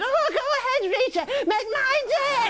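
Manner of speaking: a feeble Dirty Harry, squeaky; Les Dennis as Eastwood. Acted. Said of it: "Les Dennis doing Clint Eastwood".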